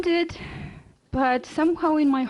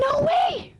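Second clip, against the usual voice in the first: sudden high shout of refusal; husband yelling no at the doctor; retold outburst; 14 semitones higher than usual. Igor refuses surgery.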